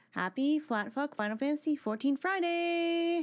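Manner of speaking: ordinary delivery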